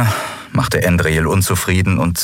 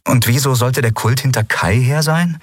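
lightly voiced young woman, higher and mocking; Lien, amused and scoffing; 6 semitones higher than usual. Lien mocks the crew's fear of the cult, amused and higher. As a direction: tease them for their fear, amused.

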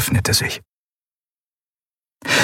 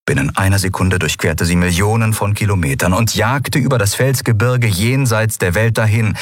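ordinary delivery, nothing marked

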